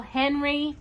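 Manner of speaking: plain reading voice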